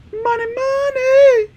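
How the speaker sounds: high slow cartoon squeak; high squeaky voice. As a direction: squeal it out, drawn out. Voicing game character.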